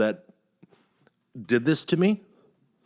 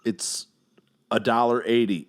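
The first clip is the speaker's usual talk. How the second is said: usual speaking voice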